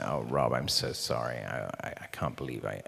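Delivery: low, hushed, faltering confession; sheepish apology. Retold or imitated. Actor confessing to his director, low quiet contrite voice. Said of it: "I just went".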